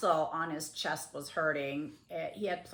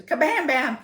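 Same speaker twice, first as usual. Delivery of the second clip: loud, higher-pitched crash imitation; imitated crash; 8 semitones higher, 12 dB louder than usual. Retelling husband falling in the bathroom, imitated crash. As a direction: punch out both bangs loudly. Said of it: "And I hear this".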